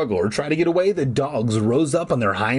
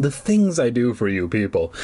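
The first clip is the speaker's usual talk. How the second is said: usual speaking voice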